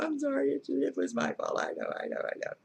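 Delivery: high, pleading sorry, then fading to a near-whisper; re-enacting a tearful apology to God; retold crying apology. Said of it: "I went back crying".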